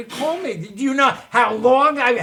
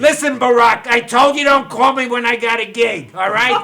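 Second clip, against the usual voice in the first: loud brusque phone voice; brushing off a caller loudly; phone call bluster; 2 semitones higher, 8 dB louder than usual. Acted. Fake phone call to Obama.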